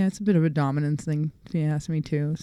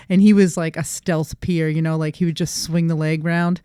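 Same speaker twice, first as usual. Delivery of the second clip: normal talking voice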